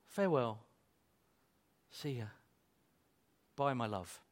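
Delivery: soft, low acted goodbye; hushed deathbed parting; tender farewell. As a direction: murmur a last goodbye to a loved one, with long pauses. Acts family's goodbye at a deathbed.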